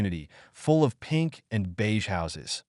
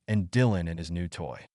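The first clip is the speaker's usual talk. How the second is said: ordinary delivery, nothing marked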